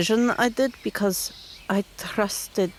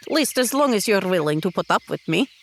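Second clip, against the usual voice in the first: ordinary talk, nothing marked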